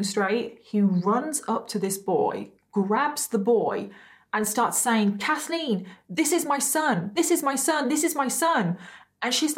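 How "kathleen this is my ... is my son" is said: put-on higher, pleading drunk repeating my son; lightly voiced drunk boyfriend, higher and insistent; drunk insistence. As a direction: mimic a drunk man insisting. Drunk man claims a child.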